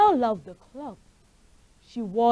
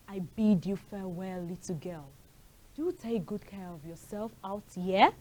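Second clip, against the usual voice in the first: low gruff wolf; 2 semitones lower, 11 dB quieter than usual. Acted. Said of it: "in a low, gruff voice".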